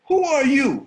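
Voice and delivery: high, loud sneering question; scornful challenge. Retold or imitated. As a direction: dare him to answer. Bully confronts guard.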